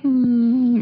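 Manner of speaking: a high, loud, knowing hum; teasing hum before saying maybe; sly hum. Acted. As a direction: hum it like you're hiding a scheme. Sly hum before admitting a plan.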